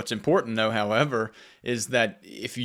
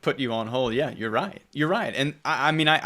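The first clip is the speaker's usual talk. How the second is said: ordinary delivery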